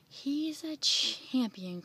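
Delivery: loud, slow, stretched-out cheer; excited cry. A narrator's reading. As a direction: crow it proudly, drawing out the words. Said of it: "cried Bill".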